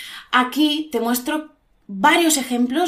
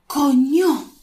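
reacting to cold; 3 semitones higher, 3 dB louder than usual. Shown as a demonstration. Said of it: "hace un frío que pela".

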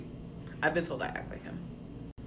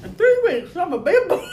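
high, loud playful boast; excited squeal; 11 semitones higher, 4 dB louder than usual. Acted. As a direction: brag like a little kid. Joking mock boast.

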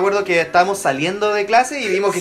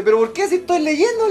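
indignant protest; 10 semitones higher than usual. Retold or imitated. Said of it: "le dije".

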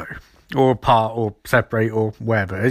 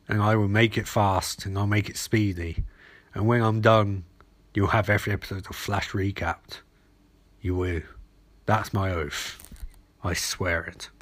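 low, hushed oath; solemn vow; 5 semitones lower, 6 dB quieter than usual. Real, not acted. Podcaster pledging to recap every episode, solemn and low. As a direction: swear it softly and gravely.

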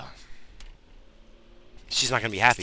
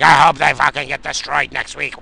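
mock bad fan; 5 semitones higher, 12 dB louder than usual. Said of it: "I'm not gonna be like".

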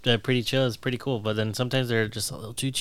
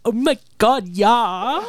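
high, loud, hammed-up excitement; mock elated; 11 semitones higher, 7 dB louder, much slower than usual. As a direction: gush along with the bit. Plays along with best-friends bit.

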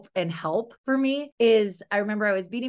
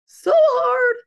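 a sudden, much higher and louder half-laughing cry on "so hard"; mock wail; 10 dB louder than usual. Mom confessing how hard it is, loud mock wail. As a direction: wail it like a comic sob.

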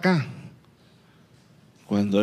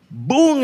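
boom sound effect; 10 semitones higher, 3 dB louder than usual. Acted. Miracle of waters parting.

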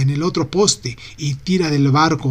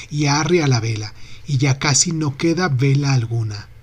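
plain reading voice

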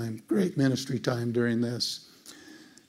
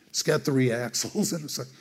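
ordinary talk

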